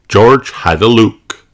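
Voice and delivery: plain reading voice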